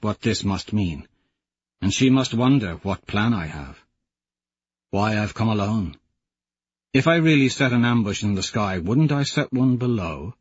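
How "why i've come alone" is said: ordinary talk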